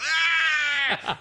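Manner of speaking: long, high, loud "aah" held on one pitch; high sustained holler; imitated phone yell. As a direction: mimic a blaring yell down the phone. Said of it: "you go".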